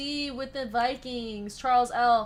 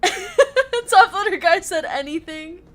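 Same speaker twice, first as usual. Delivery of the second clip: laughing while reading; 7 semitones higher, 10 dB louder, slower than usual. Real, not acted. Laughing as she reads the top loader guy comment aloud. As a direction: read the line through real laughter, slow and louder.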